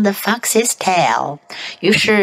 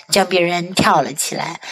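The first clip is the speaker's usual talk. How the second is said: ordinary delivery, nothing marked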